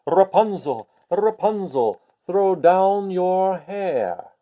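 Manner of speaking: long drawn-out call up a tower. Acted. Prince calling up to Rapunzel in her tower, slow drawn-out call. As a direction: stretch the words slowly, calling up high.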